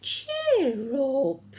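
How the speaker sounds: cheerful giant. A narrator's reading. George comforts the fox.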